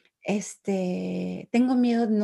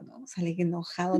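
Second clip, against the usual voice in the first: ordinary delivery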